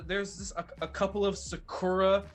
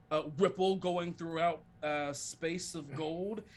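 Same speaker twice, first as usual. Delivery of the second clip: ordinary talk, nothing marked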